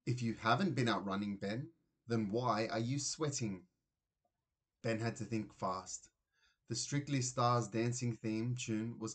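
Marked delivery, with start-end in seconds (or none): none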